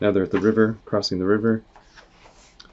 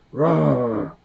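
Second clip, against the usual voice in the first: higher growling roar; bear roar; 5 semitones higher than usual. Acted. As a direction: roar like a beast. Narrator voices bear.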